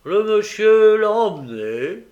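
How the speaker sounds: a booming slow policeman; policeman's voice. A narrator's reading. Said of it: "répondit l'agent".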